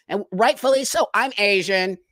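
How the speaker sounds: usual speaking voice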